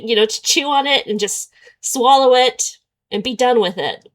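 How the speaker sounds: ordinary delivery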